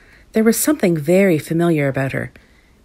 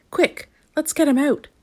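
high-pitched urgent call; 9 semitones higher than usual. A narrator's reading. The doctor rallying companions to a rescue, urgently. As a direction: urge them on, pitched up. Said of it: "said the doctor".

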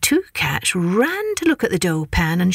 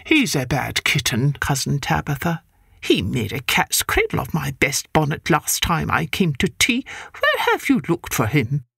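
lower, brisk no-nonsense cat; stern neighbour cat; 4 semitones lower than usual. Acted. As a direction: scold like a stern aunt.

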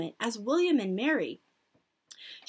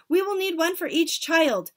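high, raised protest; cried out; 7 semitones higher, 6 dB louder than usual. A narrator's reading. Audience objecting to the plan, cried out. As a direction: insist loudly on the problem. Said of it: "cried the audience".

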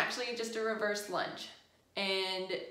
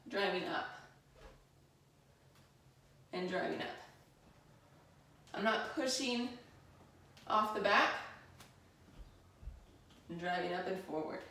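winded, breathy from lunges; out of breath; 4 dB quieter than usual. Real, not acted. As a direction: talk while catching your breath mid-exercise. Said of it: "I'm a little out of breath".

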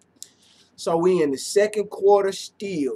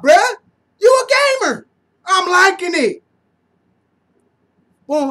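normal talking voice

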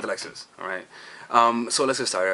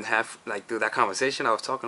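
ordinary talk, nothing marked

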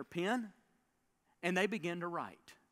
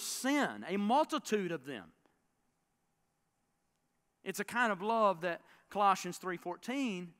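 high, loud, fervent preaching; preaching at full force; 6 semitones higher, 9 dB louder than usual. Acted. Pastor driving home the power of costly love, full-force preaching. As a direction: lift the congregation with each verse.

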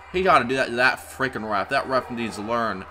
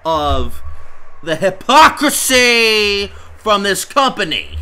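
high, loud exasperation; fed-up outrage; 6 semitones higher, 5 dB louder than usual. Real, not acted. Commentator sick of the company's hypocrisy, outraged. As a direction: cry out against the injustice. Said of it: "I'm sick of".

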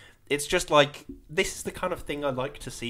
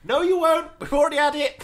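high, loud comic rebuttal; hammed-up indignant yell; mock heckling; 14 semitones higher, 8 dB louder than usual. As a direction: shout him down in play. Acted heckle answering the explorer.

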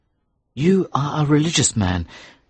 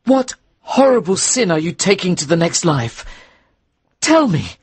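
much higher and louder, rising to a shriek; panicked young minister begging to know; frightened demand; 10 semitones higher, 4 dB louder than usual. Acted. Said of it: "cried the frightened young Reverend".

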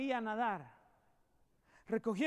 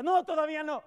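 calling out; 5 semitones higher, 6 dB louder than usual. Retold or imitated. Friend calls back to wait.

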